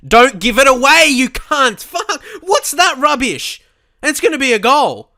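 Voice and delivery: high, loud, swearing rant at the players; rising fury, sure the goal is coming; furious yelling. Real, not acted. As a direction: scream abuse at a careless pass. Raging at his players on screen.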